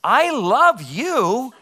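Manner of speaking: high, very slow, swooping "I love you"; hammed-up, lilting sweet talk; mock declaration of love. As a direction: draw it out like a put-on lover's declaration. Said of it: "eventually I say to her".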